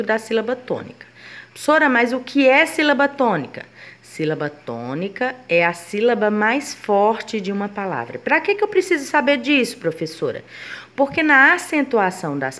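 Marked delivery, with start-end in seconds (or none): 1.5-3.6 lightly voiced pupil asking, pitched higher; student's question. Pupil asks teacher what a tonic syllable is, lightly voiced student. 8.2-10.4 higher-pitched, lightly acted pupil; student's question. Pupil asks teacher why this matters, lightly voiced student.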